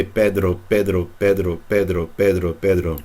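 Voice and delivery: Pedro with a clear D touching the roof of the mouth; repeated Pedro drill. Shown as a demonstration. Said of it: "you can feel the D touching the roof of your mouth".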